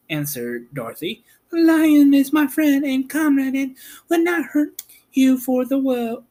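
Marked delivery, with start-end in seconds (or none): 1.5-6.2 put-on high young girl voice, curious; Dorothy's girl voice. Girl asking about Oz, high and curious. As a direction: voice the girl much higher.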